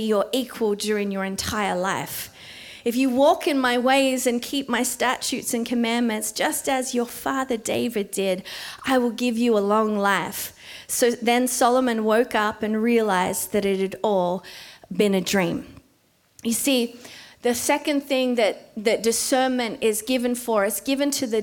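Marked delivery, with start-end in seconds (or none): none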